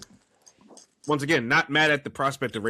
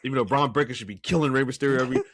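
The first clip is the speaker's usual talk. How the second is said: usual speaking voice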